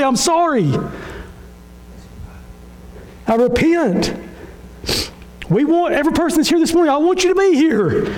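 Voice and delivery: high, insistent pulpit apology; impassioned preaching. Real, not acted. Pastor repenting of harsh words to members, impassioned. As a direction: push it out high and earnest.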